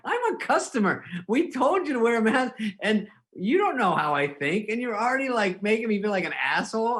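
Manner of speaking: a customer playing the wronged victim; indignant mock rant.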